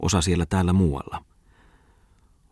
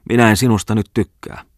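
shouting; 5 semitones higher, 5 dB louder than usual. Acted. Tommola driving the girl out, angry shout. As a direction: throw her out with a shout. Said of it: "huusi oiva Tommola".

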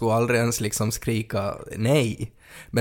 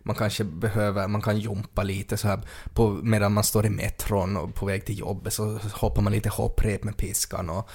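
normal talking voice

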